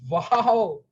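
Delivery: loud, high delighted wow; a silent cheer let out loud; inner wow. Retold or imitated. Speaker reacting to the manager's signed-book request, loud and delighted. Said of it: "I didn't say that, but from within".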